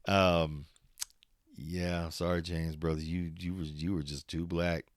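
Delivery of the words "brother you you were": normal talking voice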